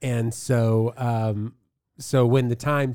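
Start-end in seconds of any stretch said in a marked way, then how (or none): none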